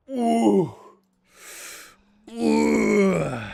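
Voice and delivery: loud, high drawn-out "ooh"; a long, loud ooh sliding down. Real, not acted. Reacting to the height rule with a loud, wincing ooh.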